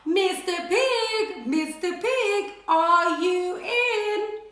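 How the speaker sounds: slow, drawn-out sing-song call. Acted. Wolf calling at the brick house door. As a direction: croon the name slowly at the door. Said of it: "I called".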